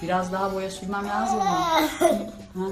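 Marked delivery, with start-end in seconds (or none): none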